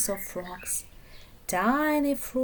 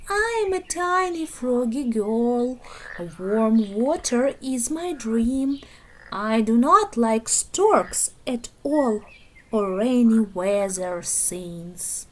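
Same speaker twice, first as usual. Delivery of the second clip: ordinary delivery, nothing marked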